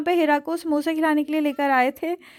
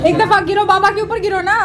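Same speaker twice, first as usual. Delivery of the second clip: playful shout; 6 semitones higher, 7 dB louder than usual. Real, not acted. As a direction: shout it out in play, higher and louder. Playfully urging child to fall. (बच्चे को खेल में ज़ोर से उकसाते हुए)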